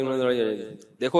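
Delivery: ordinary delivery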